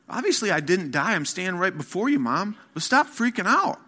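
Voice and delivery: put-on imagined teen comeback to a worried mom; exasperated teen. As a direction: brush off mom like a fed-up teenager. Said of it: "that's what was running through my head".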